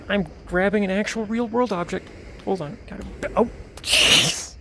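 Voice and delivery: ordinary delivery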